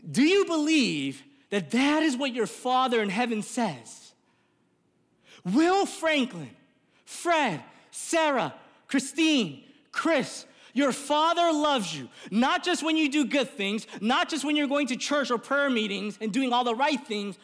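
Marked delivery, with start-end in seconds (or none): 5.4-12.1 calling out. Acted. Preacher calls congregation by name.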